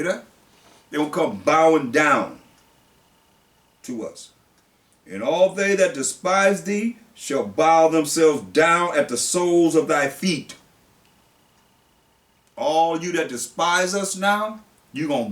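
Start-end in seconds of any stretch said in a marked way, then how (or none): 5.1-10.6 slow, louder preacher's reading of scripture; solemn pulpit reading. Acted. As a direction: read it out like the word of God.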